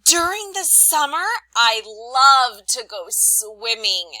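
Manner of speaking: teacher showing an excited reading of a sentence. Shown as a demonstration. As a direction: burst out thrilled about summer. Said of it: "You could have read it like this".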